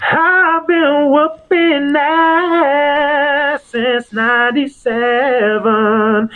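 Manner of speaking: very slow, high, drawn-out announcer call; the doctor's boast. Shown as a demonstration. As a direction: stretch every word out high and long. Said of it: "then when the doctor says".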